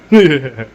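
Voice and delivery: short, loud mock laugh; imitated group laugh. Retelling how the Native Americans reacted, imitated loud laugh. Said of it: "looked at me and went".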